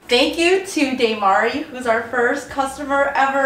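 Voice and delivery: high-pitched, emotional gratitude; overjoyed thanks. Real, not acted. Founder thanking the first customer ever, overjoyed. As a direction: gush it out with the pitch way up.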